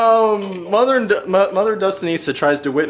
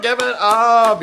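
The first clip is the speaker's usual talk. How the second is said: bright, louder host sign-off; hyped announcer; 6 semitones higher, 4 dB louder, faster than usual. Pretend. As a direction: hype up the crowd like an emcee. Announcer closing the act.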